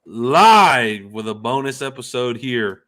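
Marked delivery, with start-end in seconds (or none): 0.1-1.1 loud, high, drawn-out opening shout; big high-pitched kickoff holler; show-opening call. Real, not acted. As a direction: belt out the show opener, stretching the words. Host opens the live show.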